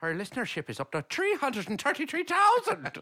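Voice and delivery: high, excited mock-Irish announcement; hammed-up Irish, rising to a peak; Irish accent. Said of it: "I could do an Irish".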